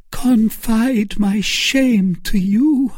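high, slow cry of shame; anguished cry. A narrator's reading. Milady crying out to Felton in shame, high and slow. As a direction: cry out in wounded modesty. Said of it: "cried Milady".

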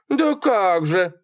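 high, drawn-out retort; slow, high-pitched scoff; scornful Sally. A narrator's reading. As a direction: stretch it out in her high voice. Sally retorts while leaving.

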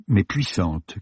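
ordinary delivery, nothing marked

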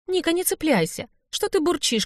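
put-on high-pitched girlish scolding; light, high reproach; chiding a friend. A narrator's reading. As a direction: tell off a grumbling friend in a high voice. Friend scolds grumbling heroine.

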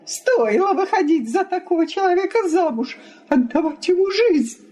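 high, bitter wifely outburst; spiteful cry. A narrator's reading. Wife regrets marrying him, bitter and spiteful. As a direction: lament bitterly, pitched high. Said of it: "злобно вскричала Елена Ивановна".

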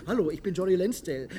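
high, silly put-on voice; mock tourist diver. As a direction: parody a clueless tourist. (spöttisch nachgeäffter Sporttaucher)

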